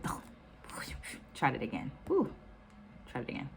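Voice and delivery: real whispered aside after fumbling a word. Real, not acted. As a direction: breathe it to yourself after a slip, half amused. Flubbed word, mutters to herself.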